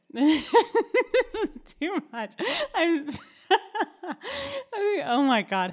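high, loud, drawn-out words through laughter; completely losing it, laughing too hard to speak; helpless laughter. Real, not acted. Host can't go on reading aloud, helpless laughter.

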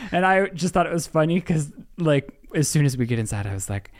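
normal talking voice

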